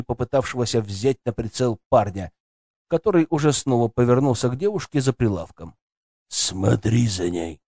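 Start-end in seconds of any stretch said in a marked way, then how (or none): 6.3-7.6 low, slow commanding order; stern order. A narrator's reading. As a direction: give the order slowly and firmly. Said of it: "приказал он". (строгий приказ)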